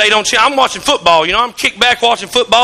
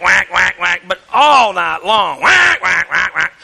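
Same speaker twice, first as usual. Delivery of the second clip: mock duck call whacks, dropping lower; imitating duck call; 6 semitones lower, slower than usual. Pastor mimicking his son's duck call in a mock call. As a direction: ham up the duck call noise. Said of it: "It's okay to hear a whack".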